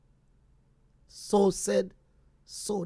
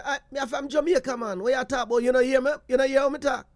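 thick Jamaican patois, a bit higher and quicker; Jamaican accent; 4 semitones higher, faster than usual. Retold or imitated. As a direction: lay on the Jamaican accent proudly. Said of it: "the Jamaican accent in the man".

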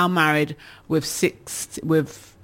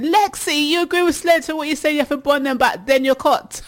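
high, fast, amazed challenge; can't believe Lexi agrees with this; incredulous; 9 semitones higher than usual. Real, not acted. Host reacts to Lexi agreeing.

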